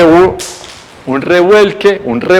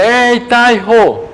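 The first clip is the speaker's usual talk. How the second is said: calling out; 8 semitones higher, much slower than usual. Retold or imitated. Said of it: "Y dice".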